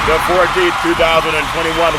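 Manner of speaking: ordinary talk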